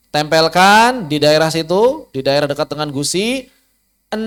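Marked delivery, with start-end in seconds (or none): 4.1-4.2 "en" with a held hum through the nose; nasal n. Shown as a demonstration. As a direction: let "en" ring through the nose. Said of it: "Begini kurang lebih". ("en" berdengung lewat hidung)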